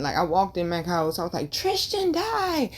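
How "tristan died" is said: high, slow, breaking voice as if crying; retold crying. Telling her fiance's friend that Tristan died, in a high, slow, breaking voice. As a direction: let the news crack out high and slow. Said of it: "I'm crying".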